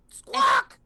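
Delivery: shrill bird squawk; high screech; parrot squawk. Acted.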